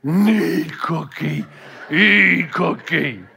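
low, slow monster grunt; deep dragging monster voice; Cookie Monster voice. Retold or imitated. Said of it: "The Cookie Monster says".

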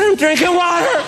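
high put-on kid shout; child calling back. Child answers parent from bathroom, mock calling out. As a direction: imitate a kid hollering an answer.

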